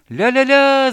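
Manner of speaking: high, loud singsong holler; wild, very high yell; gleeful yelling. A narrator's reading. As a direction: belt out nonsense syllables. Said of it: "завопил медвежонок".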